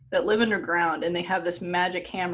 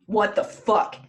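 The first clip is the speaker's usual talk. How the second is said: louder, retold burst of disbelief; mock shocked outrage; 3 semitones higher, 5 dB louder, slower than usual. Retelling Freya finding her hair gone, mock outrage. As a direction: act out a mildly outraged reaction. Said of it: "She's like".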